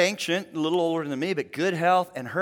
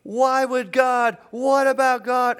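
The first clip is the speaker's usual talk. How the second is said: higher and louder, slow pleading question; anguished widow; 8 semitones higher, 7 dB louder, slower than usual. Retold or imitated. Grieving widow at the hospital questioning God, higher and louder. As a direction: cry out in grief and bewilderment. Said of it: "she is standing there saying".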